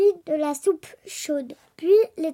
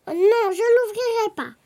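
higher-pitched little pig refusal; firm no in a raised pig voice; defiant little pig; 5 semitones higher, 4 dB louder than usual. A narrator's reading. Little pig refusing to open up to the wolf, defiant.

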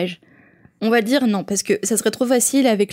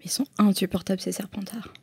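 whispering; 8 dB quieter than usual. Pretend. Conspiratorial aside about Slytherins.

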